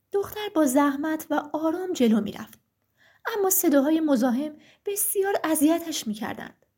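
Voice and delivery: plain reading voice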